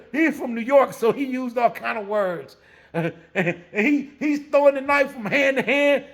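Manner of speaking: usual speaking voice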